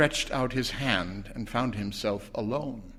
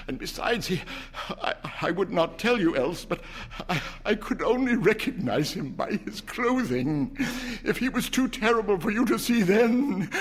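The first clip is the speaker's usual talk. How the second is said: shaking voice; 9 semitones higher than usual. A narrator's reading. Husband warning his wife in a shaking voice. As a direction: let the voice shake with dread. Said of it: "his voice shook".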